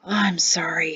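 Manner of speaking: breathy whisper. A narrator's reading. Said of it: "he breathed".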